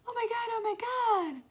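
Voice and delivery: very high, loud squeal of excitement; high-pitched, overjoyed cry; excited scream. A narrator's reading. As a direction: scream it like a thrilled mother. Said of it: "Mom was screaming with excitement".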